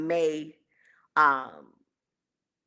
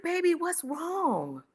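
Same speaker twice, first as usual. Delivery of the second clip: tender concern; 12 semitones higher, slower than usual. Retold or imitated. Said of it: "and I said".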